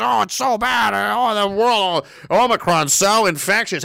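mock the doom-mongers; mock panic. Host mimics alarmist reports, hammed-up panic.

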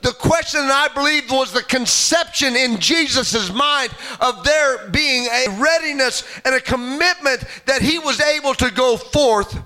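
ordinary talk, nothing marked

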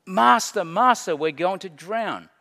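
loud, high calls for help; desperate cry. Acted. Believers in life's storms praying to God, crying out. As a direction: cry out as if going under. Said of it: "crying out to God for help".

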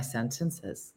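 "sentences" with the pitch going up at its end; upward ending. Shown as a demonstration. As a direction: let "sentences" tip upward as it ends. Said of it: "I kept going up at the end".

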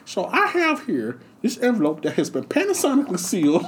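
high squeaky lisping Tyson voice, way up; Mike Tyson impression. Tyson doing his monologue to the crowd, in a high squeaky voice. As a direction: mimic Tyson's high voice hard. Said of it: "with Mike Tyson and Don King".

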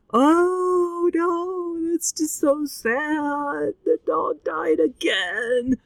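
high, drawn-out whimpering lament; tearful wail. Real, not acted. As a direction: wail it slowly, on the edge of tears. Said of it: "I am tearing up right now".

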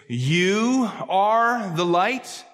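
You very slow and weighted; stress on you. Shown as a demonstration. Preacher telling the church they are light, stress on You. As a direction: land hard on the word You, slowly. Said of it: "the same emphasis on the word you".

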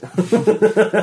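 high loud burst of laughter; sudden big laugh. Real, not acted. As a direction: crack up loudly.

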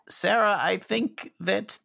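put-on anxious young hostage. GM voices scared NPC, higher.

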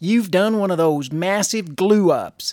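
folksy local radio ad; Edna ad read. Acted. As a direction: sell it like a small-town hardware store ad. Reads a spoof hardware ad.

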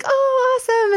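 high-pitched retold gush; mock delight. Bride-to-be retelling putting the ring on, mock delighted. Acting out own reaction to ring.

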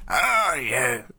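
put-on slow, mysterious old man; lightly voiced wise elder. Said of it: "old man standing outside the museum like".

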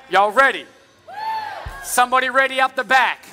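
reach the back row with your voice; calling out. Real, not acted.

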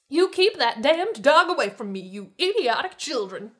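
high, loud, venomous scolding; loud, haughty outrage; angry hissing. A narrator's reading. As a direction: scold them furiously, voiced not whispered. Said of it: "she hissed".

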